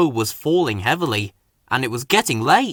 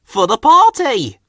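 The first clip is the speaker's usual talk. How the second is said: higher, louder engine voice; chirpy little engine; Rusty voice; 8 semitones higher, 8 dB louder than usual. A narrator's reading. Rusty explaining the cargo run, higher and louder. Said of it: "Rusty told Freddy".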